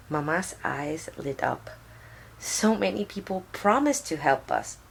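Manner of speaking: ordinary delivery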